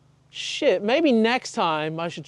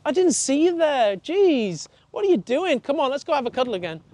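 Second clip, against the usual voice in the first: much higher, fast, gushing greeting; theatrical welcome; 7 semitones higher than usual. Shown as a demonstration. Trainer pretending surprise at the dog, in a much higher, fast theatrical voice. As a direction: act amazed the dog is there. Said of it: "we're, like, theatrical with it".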